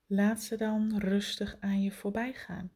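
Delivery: right in your ear. Acted. Intimate meditation cue, whispered.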